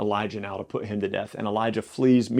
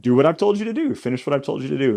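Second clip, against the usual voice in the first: high, louder opening, then quicker and easing; exasperated insistence; 3 semitones higher, 3 dB louder, faster than usual. Retold or imitated. God to people he gave clear instruction, exasperated. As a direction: insist like a fed-up boss, imitated.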